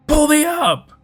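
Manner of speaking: high, loud panicked yell; hysterical shout. Acted. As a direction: scream it near hysterics. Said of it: "nearing hysterics".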